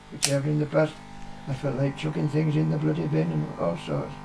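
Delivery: ordinary talk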